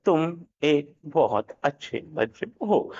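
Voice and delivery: slow, drawn-out delivery; slow version of the sentence; said slowly. Shown as a demonstration. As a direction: stretch each word out slowly. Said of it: "धीरे बोला है".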